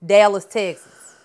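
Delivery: drawn-out Southern belle drawl on "Dallas, Texas"; southern accent. Pretend. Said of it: "That's my southern accent".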